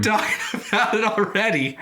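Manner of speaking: high-pitched, laughing protest; laughing reluctance. Real, not acted. Cohost backing out of awkward follow-up questions, laughing high protest. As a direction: beg off through giggles.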